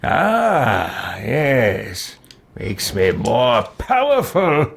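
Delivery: a smug, scheming villain musing over his gains. Acted. Villain gloating over his new minions, smug and scheming. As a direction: savour each word like a gloating dark lord.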